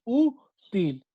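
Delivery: slow "útil", the ú stretched and stressed. Shown as a demonstration. Teacher showing the tonic syllable, "útil" with stressed ú. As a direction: lean on the ú in "útil", then drop to til. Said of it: "Es la que suena más, la U".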